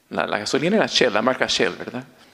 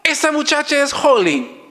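high, eager imitation of his friend whispering gossip; excited friend; 13 semitones higher than usual. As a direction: mimic an excited friend pointing someone out. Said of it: "Me agarra y me dice". (imitando a una amiga emocionada, voz aguda)

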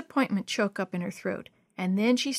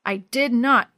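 loud, snapped denial; snapping; 2 semitones higher, 7 dB louder, slower than usual. A narrator's reading. Tevin denying the theft to everyone, loudly snapping. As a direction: snap back at the accusation. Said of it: "Tevin snapped".

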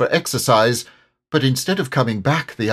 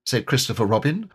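plain reading voice

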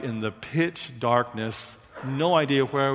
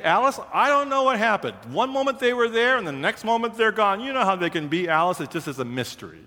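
mock innocent dad; 7 semitones higher, faster than usual. Dad feigns innocence to wife.

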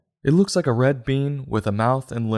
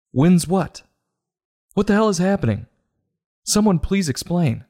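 exasperated; 6 semitones higher than usual. Real, not acted. Ranting at a baffling book.

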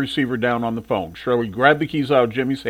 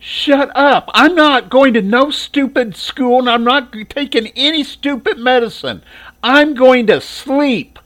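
put-on much higher, louder, slow defiant girl; angry refusal; 11 semitones higher, 6 dB louder, slower than usual. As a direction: spit out a stubborn no. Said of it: "Patricia said".

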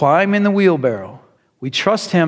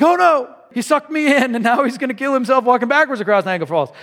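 high-pitched comic dismay, speeding up; hammed-up alarm, rushing into the worry; mock panic; 7 semitones higher, faster than usual. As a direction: wail it like a horrified onlooker. Vacationer alarmed at backwards walk.

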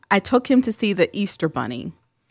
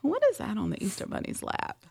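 hushed, higher puzzled question; dawning disbelief; 4 semitones higher, 11 dB quieter than usual. Retold or imitated. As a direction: drop to a hush, baffled by what you see. Mother spots mess, dawning horror.

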